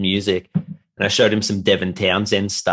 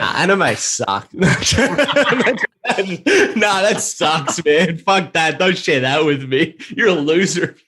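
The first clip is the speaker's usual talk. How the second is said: higher, slow hammed-up contempt; teasing a friend in a fake dismissive voice; mock scorn; 7 semitones higher than usual. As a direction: play the snob who sneers at anime. Mock anime hater teasing friend.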